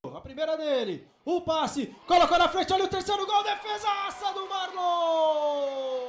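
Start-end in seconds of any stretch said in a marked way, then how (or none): 1.2-6.1 excited shout. Real, not acted. Big save.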